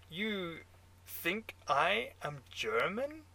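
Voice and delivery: put-on incredulous question in a German accent. A narrator's reading. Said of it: "your German accent".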